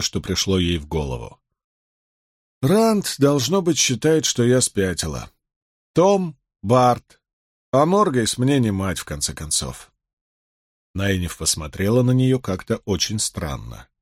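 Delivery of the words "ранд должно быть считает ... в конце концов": ordinary delivery, nothing marked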